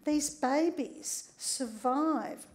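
usual speaking voice

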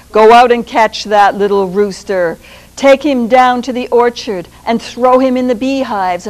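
commanding Sultan. Acted. Sultan ordering the rooster thrown into the beehives, loud. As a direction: Sultan sends the servants out.

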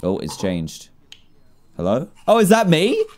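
ordinary talk

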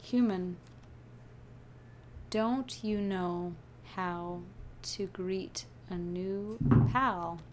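low, slow, halting delivery; mysterious stranger. Acted. Stranger behind the human demands a greeting, slow and low. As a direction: space the words out ominously. Reads mysterious figure's lines.

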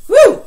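high, loud cheer; a loud, high "Woo!" of excitement; excited woo. Real, not acted. Teacher cheering as the class gets the board out, loud.

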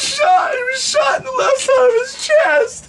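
high wailing mock-tearful cry; mock sobbing. Telling friends Dave got shot, mock sobbing. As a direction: wail the news like you're falling apart. Comic mock-tragic announcement.

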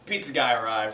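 normal talking voice

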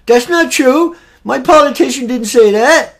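mock indignant protest. Political follower fighting back against the other side, mock indignant.

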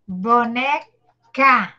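BONECA drawn out with a break between syllables. Shown as a demonstration. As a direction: break "Boneca" slowly into bo-ne-ca, low. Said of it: "eu vou falar nas sílabas". ("Boneca" pausado, sílaba por sílaba)

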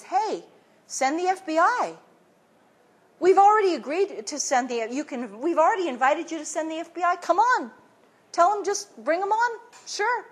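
high, airy imitation of the Iraqis; breezy mock reply.

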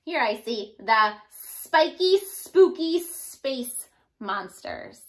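ordinary delivery, nothing marked

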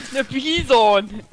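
"Bison" drawn out slowly. Shown as a demonstration. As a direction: say "Bison" slow and careful. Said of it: "Wie heißt das?".

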